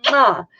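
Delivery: quick smacking air kiss; quick kiss goodbye; kiss sound. Real, not acted. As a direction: blow a kiss. Blowing a kiss to viewers.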